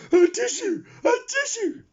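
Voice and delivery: very high sneezing words. A narrator's reading. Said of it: "sneezed the pig".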